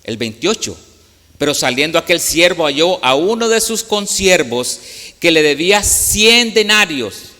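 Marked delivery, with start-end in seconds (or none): 1.4-7.3 louder, higher pulpit reading; scripture reading. Acted. Preacher reading the parable aloud, louder and proclaiming. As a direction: proclaim the verse to the congregation.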